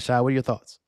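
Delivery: ordinary talk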